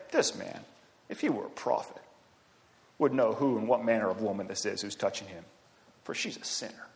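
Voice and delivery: hushed, scornful aside to himself; a sneering Pharisee muttering under his breath; muttered under his breath. Acted. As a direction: say it half to yourself so others still hear. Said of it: "Can't you tell kind of the tone of voice".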